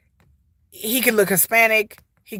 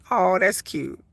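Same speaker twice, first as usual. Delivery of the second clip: normal talking voice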